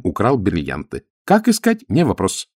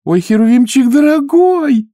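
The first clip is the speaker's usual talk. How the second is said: put-on high, loud girlish squeal; squealing with joy; 7 dB louder than usual. A narrator's reading. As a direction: squeal with delight. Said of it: "запищала от радости".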